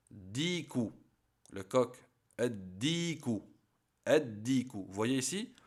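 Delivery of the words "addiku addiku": "addiku" with no L and a held double D; lam silent. Shown as a demonstration. Teacher shows the lam is no longer pronounced, doubled D in "addiku". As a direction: say "addiku", going straight into the doubled D. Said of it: "on ne prononce plus le lem".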